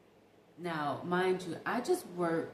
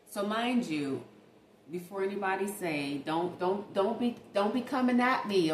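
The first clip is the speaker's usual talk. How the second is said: usual speaking voice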